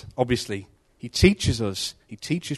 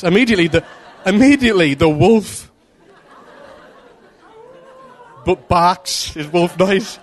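giggling through the words; laughing; 5 semitones higher, 6 dB louder than usual. Real, not acted.

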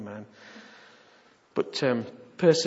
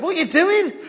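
a hint of puzzled wife; 11 dB louder than usual. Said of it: "she said".

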